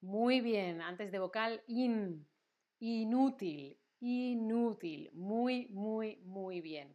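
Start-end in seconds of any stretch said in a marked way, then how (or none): 2.8-5.1 "Inútil" slowly, drawing out the opening "in". Shown as a demonstration. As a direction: stretch "Inútil" to show the n. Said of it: "con N".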